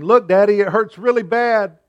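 put-on a hurt child showing his pinched finger; suffering child. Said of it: "with an expression of suffering".

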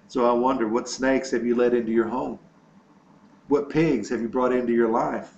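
normal talking voice

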